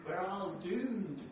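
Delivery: slow, drawn-out Scottish gloom; hammed-up Scottish doom-monger's catchphrase; bad Scottish accent. Preacher imitating a sitcom pessimist for the congregation, bad Scottish accent. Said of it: "I can't do a good accent".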